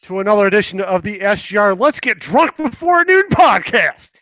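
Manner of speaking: big podcast host welcome, stretched out; announcer intro. Acted. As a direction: rise high on the show name like an announcer.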